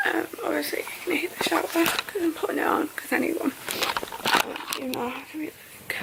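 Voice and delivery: soft whisper over the shower. Real, not acted. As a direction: whisper it, sore and tired.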